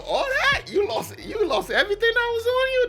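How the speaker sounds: normal talking voice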